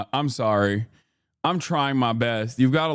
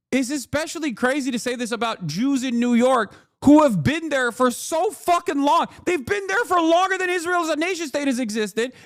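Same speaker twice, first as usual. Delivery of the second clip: exasperated rant; 10 semitones higher than usual. Real, not acted. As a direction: rant in disbelief, voice pushed way up.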